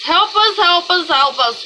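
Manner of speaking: hammed-up loud plea for help; mock pleading cry. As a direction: beg heaven loudly in mock despair. Mock prayer escalating to pleading.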